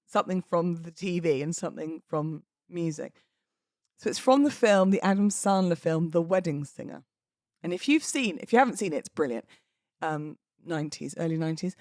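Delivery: ordinary talk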